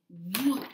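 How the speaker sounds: a rushing whoa like a wave; whooshing whoa. Shown as a demonstration. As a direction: push out the whoa like a wave coming in. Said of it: "it goes like this".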